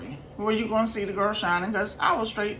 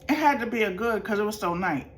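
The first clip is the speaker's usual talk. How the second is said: normal talking voice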